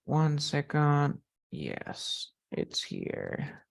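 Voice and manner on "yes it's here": very low, soft murmur; muttering to oneself. Real, not acted. Teacher to self while finding the lesson, low mutter. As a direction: mumble it under your breath, much lower.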